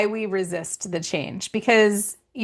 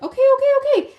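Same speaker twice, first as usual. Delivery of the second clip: high, loud string of okays; overwhelmed giving in; 7 dB louder, faster than usual. Acted. Listener gives in to nagging signs, mock overwhelmed. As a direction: rattle them off high and loud. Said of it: "the signs get really loud".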